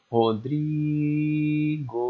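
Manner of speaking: mock crowd call, stretching the DRI of Rodrigo; stretched stadium call. Shown as a demonstration. Teacher pretends to be in an organised crowd, stretched call on DRI. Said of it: "você vai gritar assim".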